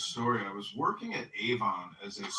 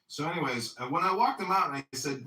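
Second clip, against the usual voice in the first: normal talking voice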